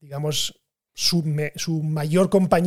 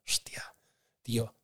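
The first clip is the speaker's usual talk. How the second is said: whispered aside; 7 semitones lower, 13 dB quieter than usual. Real, not acted. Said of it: "whispered aside".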